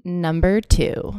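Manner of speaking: slow, loud sultry number reveal; sultry narrator. Acted. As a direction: linger on it like a seductive host. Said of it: "Ooh, that was better".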